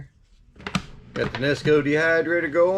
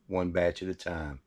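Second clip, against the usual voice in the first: ordinary talk, nothing marked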